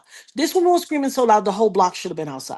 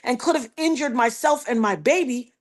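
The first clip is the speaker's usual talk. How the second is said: usual speaking voice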